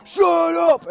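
put-on guy screaming at kids to shut up; furious yell. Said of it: "yelling at us".

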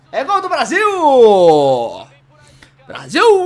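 excited goal shout; goal celebration. Real, not acted. Goal celebration in FIFA stream.

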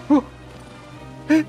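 ordinary talk, nothing marked

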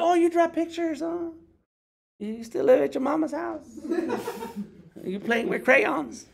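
high mocking voice, patronizing; condescending mockery. A dismissive stranger mocking an artist, high and condescending. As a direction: talk down like to a child.

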